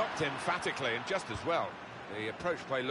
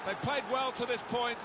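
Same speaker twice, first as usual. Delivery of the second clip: high, energised play-by-play; excited goal commentary; 7 semitones higher than usual. Acted. Commentator after a two-goal lead, excited. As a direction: ride the wave of the goal in a raised voice. Describes a goal just scored.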